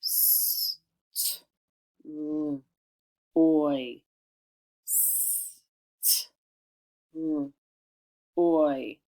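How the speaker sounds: "S, t, r, oy" one isolated sound at a time; long hissed "S", tiny "t", "r", then full "oy". Shown as a demonstration. Teacher tapping out syllable, "S, t, r, oy" with pauses. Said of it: "your second syllable is stroy".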